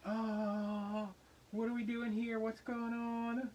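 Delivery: high, slow, baffled; stretched-out disbelief; bewildered. Retold or imitated. Bewildered at the dance.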